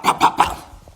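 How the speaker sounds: whispered bop bop bop sound effects; mock punch sounds. Retelling James getting hit, voiced punch noises. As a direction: mimic the hits with breathy pops. Imitating hits with rings.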